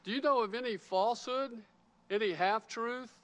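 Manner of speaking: ordinary talk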